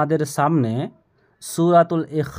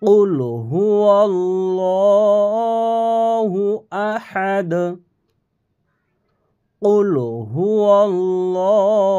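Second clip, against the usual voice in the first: slow chanted recitation, higher and louder; Quran recitation; 4 semitones higher, 4 dB louder, much slower than usual. Shown as a demonstration. Teacher recites for students to copy, slow chant. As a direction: recite slowly with long vowels. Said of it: "আমার সাথে সাথে আপনারাও বলেন".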